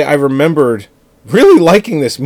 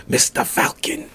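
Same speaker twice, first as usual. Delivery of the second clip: whispered; 9 dB quieter than usual. Retold or imitated. Repeating dub line, whispered.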